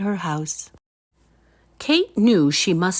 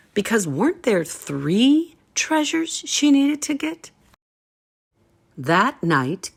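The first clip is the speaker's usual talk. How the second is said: usual speaking voice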